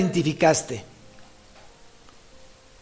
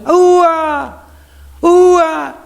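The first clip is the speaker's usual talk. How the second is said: high, loud, long-drawn holler, repeated; shepherd's own call; 9 semitones higher, 5 dB louder, much slower than usual. Retold or imitated. True shepherd arrives to call his sheep, loud herding holler. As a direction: call the flock in. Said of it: "el mismo sonido".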